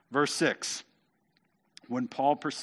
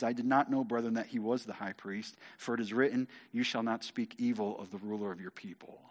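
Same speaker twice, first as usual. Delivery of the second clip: subdued, softer and lower apology; repentant; 4 semitones lower, 7 dB quieter than usual. Acted. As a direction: back down humbly, quietly quoting. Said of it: "I think this is repentantly".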